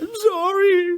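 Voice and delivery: very high, loud fake-crying voice; mock tearful apology. Apologising for the wasted day, mock tearful wail. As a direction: wail it like a pretend sob. Retold apology after the complaint.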